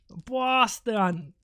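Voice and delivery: stretched-out mock Boston accent; hammed-up Boston vowels. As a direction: parody a proud Bostonian. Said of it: "sorry to everybody from Boston".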